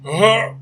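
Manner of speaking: high, loud 'oh' of horror; imitated shocked gasp. Friend looking at his bleeding back, mimicked shocked gasp. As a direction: gasp as if seeing a nasty wound.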